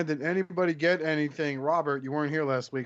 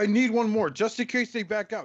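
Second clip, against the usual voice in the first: mock collector excuse; 8 semitones higher, 4 dB louder than usual. Mocking host's collector excuse.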